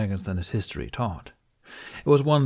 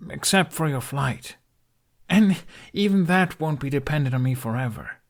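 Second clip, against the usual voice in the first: higher, agitated and self-pitying; upset outburst; 7 semitones higher, 4 dB louder than usual. Acted. Hiccup telling the dragon he drags him down, agitated and higher. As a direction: spill out the frustration.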